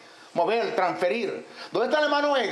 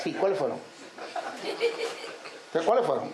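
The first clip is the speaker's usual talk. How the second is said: mock demon pressing; 6 semitones lower, 5 dB quieter, faster than usual. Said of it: "como un teatro".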